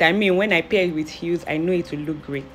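ordinary talk, nothing marked

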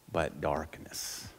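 hushed. Real, not acted. Solemn scripture phrase.

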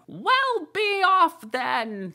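very high, loud, slow huffy bird voice; sulky. A narrator's reading. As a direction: dismiss her like a sulking pigeon. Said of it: "said the pigeon in a sulky tone".

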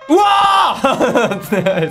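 long scared yell; startled scream. Real, not acted. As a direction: cry out in fright. Startled by game anomaly.

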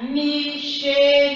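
slow, level "Micheline", each syllable held; teacher's syllable-by-syllable reading of "Micheline". Shown as a demonstration. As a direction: read "Micheline" out in stretched syllables. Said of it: "Lis les quatre syllabes".

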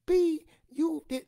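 very high, loud, slow furious tirade; cussing out. Retold or imitated. Grandmother cursing the store lady out. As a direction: unleash on her. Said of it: "cursed that lady out so bad".

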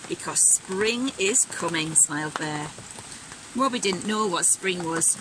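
put-on bright, animated read-aloud for kids; lively storybook reading. A narrator's reading. Teacher reading Robin's winter story aloud, animated and bright. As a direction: act the story out with energy.

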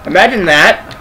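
ordinary delivery

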